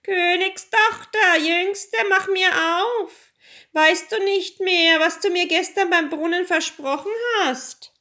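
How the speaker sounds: high, loud frog demanding entry; calling out. A narrator's reading. Frog calls a second time at the door, high and insistent. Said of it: "klopfte es zum zweiten Mal und rief".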